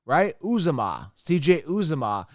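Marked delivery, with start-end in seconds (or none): 0.4-1.1 "Uzama" said "OOZ-uh-ma"; correct pronunciation. Shown as a demonstration. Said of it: "I pronounce it how it sounds". 1.6-2.3 "Uzama" as "OOZ-uh-ma"; correct pronunciation. Shown as a demonstration. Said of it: "I pronounce it how it sounds".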